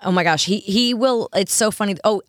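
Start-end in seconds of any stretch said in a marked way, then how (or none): none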